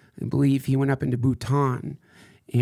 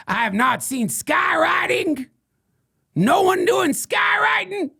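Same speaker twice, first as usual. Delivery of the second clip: high, loud, slow indignant yelling; outraged shout; 6 dB louder than usual. Acted. Ranter yells at his followers, high outraged shout. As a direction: bellow it like the world has failed you.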